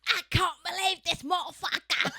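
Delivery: put-on high, loud London accent with a laugh. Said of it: "I either think [name]".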